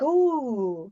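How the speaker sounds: high, drawn-out oohing; slow, high-pitched gawking, hammed up; mock wonder. Recalling gawking at a candle ceremony, high mock wonder. Acting out the group's curious wonder.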